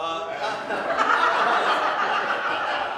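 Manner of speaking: big burst of laughter; loud laugh. Real, not acted. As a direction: crack up loudly. Host laughs at suggestion.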